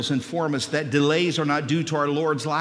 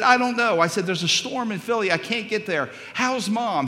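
calling out high and loud to be heard; raising voice on phone; 8 semitones higher, 6 dB louder than usual. Retold or imitated. Son shouting to his dad on the phone. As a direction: shout down a bad phone line. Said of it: "raising my voice".

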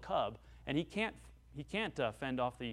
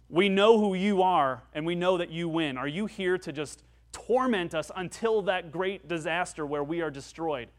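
terrified demons; 3 semitones higher, 7 dB louder than usual. Acted. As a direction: beg Jesus as a frightened demon. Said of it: "They say".

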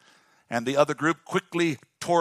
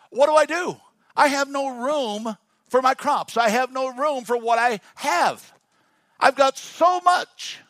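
higher, louder rich man worrying over his crops; rich man fretting; 7 semitones higher, 4 dB louder than usual. Acted. Preacher voicing the rich man fretting over crops, higher and louder. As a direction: play the anxious rich farmer, voice up.

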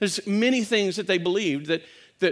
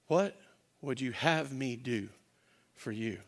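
soft, lower, tender question; gentle servant question; 7 semitones lower, 10 dB quieter than usual. Acted. Jesus gently asking the blind man, soft and low. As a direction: ask it humbly like a servant.

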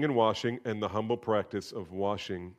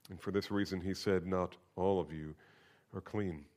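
scripture reading. Acted. Pastor reading the gospel to the congregation, solemn. Reads the gospel passage aloud.

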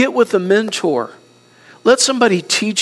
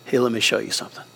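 gentle nudge; 9 semitones lower, 8 dB quieter, much faster than usual. Retold or imitated. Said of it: "leaned over my shoulder and said".

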